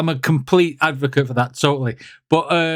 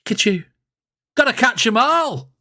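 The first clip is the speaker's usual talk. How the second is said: excited imitation; 8 semitones higher than usual. Imitates singer spotting Pikachu.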